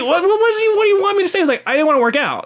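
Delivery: high-pitched mock exasperation; exasperated comeback. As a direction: retell your protest in a high, squeaky-high pitch. Retold exasperated argument with coach.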